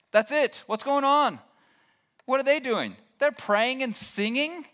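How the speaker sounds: acted indignant disbelief, high-pitched and loud at first; high, exasperated "what is going on?" voice; exasperated outburst. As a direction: play fed up and incredulous, pitch way up. Acts out exasperated, disbelieving complainer.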